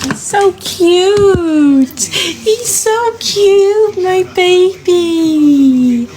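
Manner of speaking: high, very slow cooing over the dog. Real, not acted. Owner gushes high and slow to her dog. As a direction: stretch every word out, cooing.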